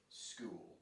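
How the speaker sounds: "School" said low and slowly stretched; drawn-out demonstration. Shown as a demonstration. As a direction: stretch out "School" for someone to copy. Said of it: "I want you to repeat after me".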